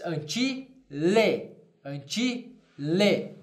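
drawn out, each syllable clear; slow careful Latin model. Shown as a demonstration. Teacher modelling Latin slowly for students. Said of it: "eu diria".

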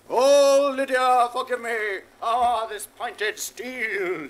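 loud, high, swooning plea drawn out slowly at the end; mock-heroic lover. Jack acting out his plea to Lydia, hammed-up lover. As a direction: ham up a lovesick suitor's vow. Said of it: "says I".

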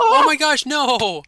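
high, loud gasp of surprise; startled, the words jump out high and loud; oh my gosh, caught off guard; surprised. Real, not acted. Spotting the other guy.

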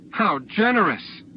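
high, drawn-out indignant exclamation; bitter cry. A narrator's reading. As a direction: cry it out slowly in outrage. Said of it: "Sana cried".

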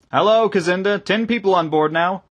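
loud, high hail below deck; calling down. A narrator's reading. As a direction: announce it down the hatch. Calling down into the dredger.

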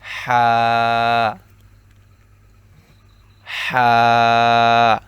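loud হা breathed from mid throat. Shown as a demonstration. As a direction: push হা out from the throat. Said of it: "গলার মধ্যখান হইতে".

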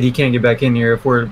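ordinary delivery

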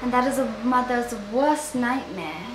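put-on accidental British accent on the line. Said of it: "You know, you British accent".

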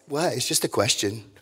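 put-on dropped low and soft, "just" muttered like a sulky kid; soft mock-innocent sibling shrug; sulky younger sibling. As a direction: play the innocent little brother, quietly. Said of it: "who has a younger sibling?".

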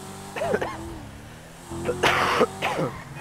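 harsh hacking cough; real cough. Real, not acted. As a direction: cough it out hard. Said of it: "Got a bit of a cough".

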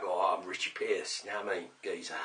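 mock Cockney. Teasing a customer about his name, mock Cockney. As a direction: play him as a Cockney lad. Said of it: "You sound like you've got a Cockney name".

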